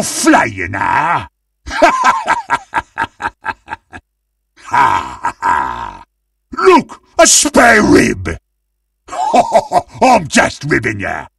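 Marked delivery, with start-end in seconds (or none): 1.7-3.7 long, very high giggling cackle; villainous cackle. Acted. Villain laughs after threat. 9.1-10.0 short, high-pitched giggle; joking cackle. Acted. Laughs at own rib pun.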